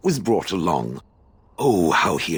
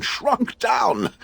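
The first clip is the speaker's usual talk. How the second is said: "shrunk down" leapt much higher in indignant disbelief; outraged. Real, not acted. Golem telling the Warden of its shrinking, higher and outraged. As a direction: fling "shrunk down!" up high, appalled.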